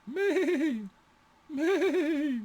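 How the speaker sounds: high sheep bleat. Shown as a demonstration. Teacher mimicking a sheep for students, high bleat.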